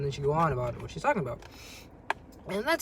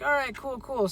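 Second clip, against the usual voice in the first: upbeat; 9 semitones higher than usual. Pretend. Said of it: "be like".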